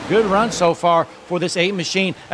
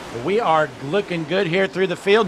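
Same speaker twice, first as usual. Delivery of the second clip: ordinary talk, nothing marked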